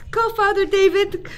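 loud, rising panic; urgent calling out. Acted. Mother shouting to her husband to fetch the priest, loud and panicked. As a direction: yell for help, desperate.

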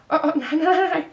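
high, loud, panicked stutter; alarmed stammer. A narrator's reading. Frightened boy reaching out for his stricken friend, stammering loudly. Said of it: "stammede han".

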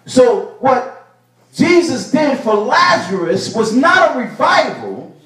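normal talking voice

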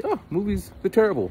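a hint of the dad scoffing at movies; mocking dad. Dad brushing off movies, mild mock scoff. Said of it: "I just let him say".

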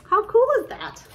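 high, loud delighted exclamation; excited. Real, not acted. Stitcher thrilled the gems match DMC floss, excited burst.

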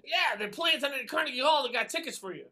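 high, loud, thrilled news; excited mother. Retold or imitated. Mother telling her son about Carnegie Hall, excited and loud. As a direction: burst out with the big news. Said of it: "I said".

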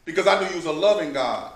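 loud, rising sermon voice, driving each point home; preaching at full force. Acted. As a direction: preach it to the saints with fire.

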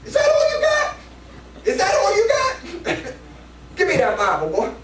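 high, loud laughing challenge; teasing old elder. Retold or imitated. Old elder mocking the worn-out preacher, high loud laughing challenge. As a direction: taunt the tired young preacher. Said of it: "little Elder West came up and said".

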